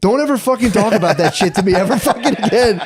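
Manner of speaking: high, laughing mock order; half-laughing fake threat to the partner; mock command. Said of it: "I'm like".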